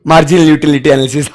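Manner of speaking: laughing scornfully through the words; mocking laughter. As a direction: sneer at it while laughing. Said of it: "ऐसा हंस रहे martial पे".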